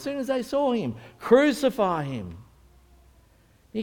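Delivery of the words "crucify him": slow, loud, high chant-like demand; crowd cry. Acted. Crowd insisting to Pilate on execution, slow acted shout. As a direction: draw out the mob's loud call. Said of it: "what does the crowd say".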